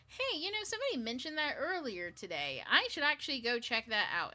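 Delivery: excited recognition. Retold or imitated. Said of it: "And I go".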